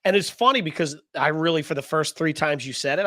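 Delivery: ordinary delivery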